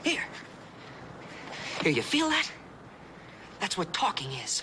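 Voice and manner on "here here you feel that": higher, eager and urgent; excited teacher realising a way through. Real, not acted. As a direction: push it out with rising excitement.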